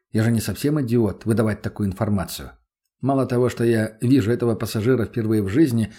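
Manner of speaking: usual speaking voice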